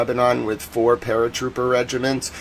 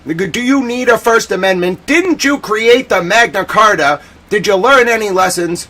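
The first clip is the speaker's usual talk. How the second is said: shouting down the Prime Minister in mock outrage, louder and higher; loud scolding; 8 semitones higher, 7 dB louder than usual. Streamer telling off the British Prime Minister, mock loud scolding. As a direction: give him a hammed-up dressing-down, loud and high. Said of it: "I will say".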